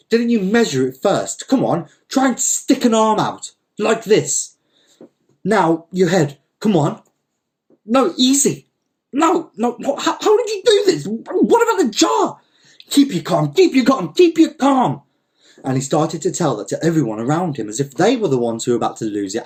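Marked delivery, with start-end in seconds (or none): 0.1-12.4 high, agitated shouting; yelling. Acted. Don Lol yelling at the man stuck in his jar. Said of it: "yelled at the old man". 12.9-15.0 rising, louder with each repeat; frantic. Acted. Don Lol telling everyone to keep calm, frantic. Panicked owner urging calm, escalating.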